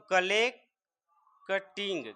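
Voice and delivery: drawn-out syllables of collecting; slow sounded-out word. Shown as a demonstration. Teacher slowly reads out collecting for learners. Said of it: "क्या हो जाएगा?".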